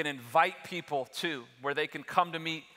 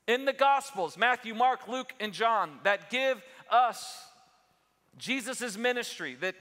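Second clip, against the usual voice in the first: rising sermon intensity; preaching at full force; 6 semitones higher than usual. Acted. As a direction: drive the point home to the church.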